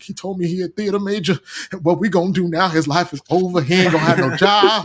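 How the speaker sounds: high, hammed-up wailing over her son's job; imitating a mother crying in despair; mock crying mom. Mom sobbing to a classmate about her son's theater major, high mock wail. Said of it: "she was crying 'cause she was like".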